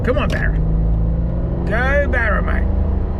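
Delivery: loud eager cheer, urging it up the hill; cheering the car on. Real, not acted. Host cheering his car up the hill, loud and eager. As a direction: egg the engine on like at the track.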